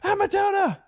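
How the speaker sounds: put-on very high squealing kid shrieks; daughters' screaming. Kids spotting a bunny at dawn, high excited screaming. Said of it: "My daughter's scream".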